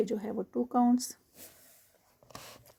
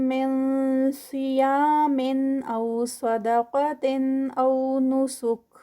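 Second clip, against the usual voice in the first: Quran recitation; 2 semitones higher, 4 dB louder than usual. Shown as a demonstration. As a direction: recite it with careful elongation. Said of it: "یہاں پر ادغام ہے".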